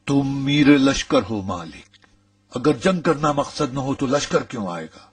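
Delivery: insistent arguing. Acted.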